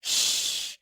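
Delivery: a sharp unvoiced shush; librarian shush. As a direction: hush someone quickly. Child hushes aunt at digging.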